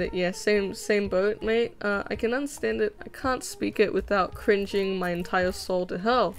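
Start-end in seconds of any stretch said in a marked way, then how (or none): none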